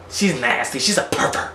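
ordinary talk

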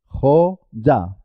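"Joya" broken into Jo, then ya; split into syllables. Shown as a demonstration. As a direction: say "Joya" in two beats, consonant y opening the second. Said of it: "porque empieza sílaba".

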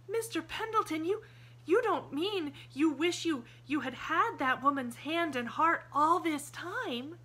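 put-on high, bright child's voice, breathless with hope; a young girl suddenly lit up with hope; eager little girl. A narrator's reading. Pollyanna's voice, hopeful.

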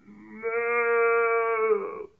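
long, loud drawn-out moo; cow moo. Shown as a demonstration. Teacher showing preschoolers the cow sound, mooing. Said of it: "it makes a sound like this".

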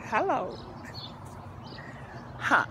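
ordinary talk

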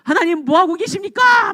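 high, anguished plea in the psalmist's voice; acted outcry of a resentful prayer; crying out to God. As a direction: cry out in complaint to God, pitch climbing. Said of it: "막 소리 지르는 거예요".

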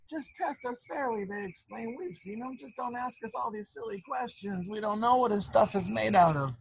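high, whining protest; whiny pleading. Acted. Proud sisters begging the sister to test them fairly, whiny and high. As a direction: whine like spoiled girls.

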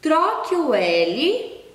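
slow emphatic instruction; teacher emphasis. Shown as a demonstration. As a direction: stress each word of "Troque o L" for the class. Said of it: "olha só o que que está pedindo".

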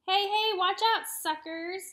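loud, high taunting yell. A narrator's reading. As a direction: shout it while speeding past. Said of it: "yelled Max".

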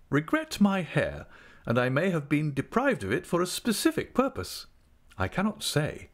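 grandly self-important speech; pompous gentleman. Acted. Mr Micawber pompous.

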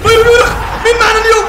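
fast, high, loud hype call; fired-up gamer yell; excited shout. Real, not acted. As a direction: shout it out like a stream opener. Hyped opening of a FIFA match.

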